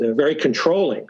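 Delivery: normal talking voice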